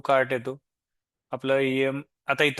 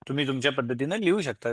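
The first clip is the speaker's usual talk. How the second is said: usual speaking voice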